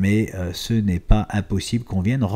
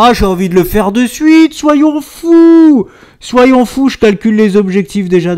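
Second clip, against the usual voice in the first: much higher and louder, carried away; excited; 12 semitones higher, 11 dB louder than usual. Acted. To viewers, deciding to calculate targets right now, excited. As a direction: throw caution away with glee.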